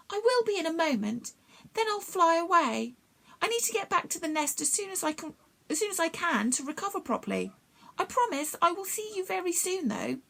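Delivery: high, tired little bee struggling to speak; a faint, effortful bee voice; the hurt bee thanking Jack, high and weary; weak bee voice. A narrator's reading. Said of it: "speaking took a lot of effort".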